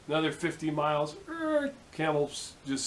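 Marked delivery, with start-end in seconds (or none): 1.3-1.7 high-pitched imitated brake screech; drawn-out high "errr" of a sudden stop; screeching-halt noise. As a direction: squeal it like tires stopping dead. Imitates camel stopping once more.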